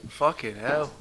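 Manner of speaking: ordinary talk, nothing marked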